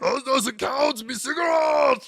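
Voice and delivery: imitating the Count bellowing in protest; gravelly monster shout. The Count losing his lit cigarette, gravelly shout. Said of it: "a deep, gravelly voice".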